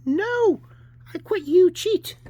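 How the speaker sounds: put-on high, loud sore-loser child; child at play. Kid quarrelling with a playmate over games, high and indignant. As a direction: cry out like a squabbling child.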